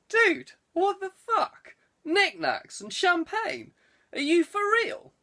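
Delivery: high, slow, disbelieving cry; incredulous outburst. Acted. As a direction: shriek it at him like he's lost his mind. Gaz reacts to knickknacks and champagne.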